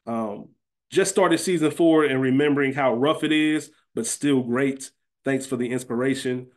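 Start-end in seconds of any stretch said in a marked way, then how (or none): none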